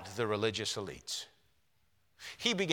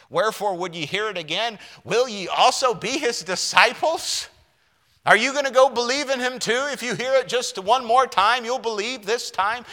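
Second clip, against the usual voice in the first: raised, loud mocking challenge; sarcastic; 9 semitones higher, 8 dB louder than usual. Healed man mocking the Pharisees, loud and sarcastic. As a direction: needle them with sarcasm, voice up high. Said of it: "a touch of sarcasm".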